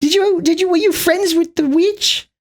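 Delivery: very high, slow, put-on munchkin squeak. A munchkin quizzing Dorothy about the witch, squeaky and very high. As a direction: pitch way up and draw out a silly little munchkin.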